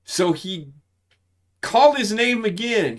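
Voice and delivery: ordinary talk, nothing marked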